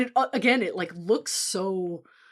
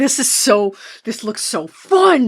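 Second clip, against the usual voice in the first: louder, slow, delighted gush; gushing excitement; 4 semitones higher, 10 dB louder, slower than usual. Retold or imitated. Recalling watching the movie, gushing loudly with excitement. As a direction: relive the thrill of wanting to play it. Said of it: "Just 'cause I was like".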